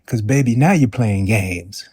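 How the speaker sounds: ordinary talk, nothing marked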